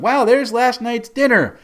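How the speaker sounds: high, loud, amused exclamation; gleeful Pepper. Acted. Pepper watching her seasick companion from above, gleefully loud. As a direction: crow with relish, pitched well up. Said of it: "Pepper's really just enjoying the show".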